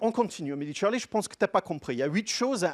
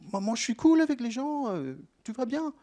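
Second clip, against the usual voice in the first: higher, breezy, carefree self-quote; mock casual self-defence; 9 semitones higher than usual. Speaker mocks his own reply to the experts, breezy and high. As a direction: lightly brush it off, pitched up.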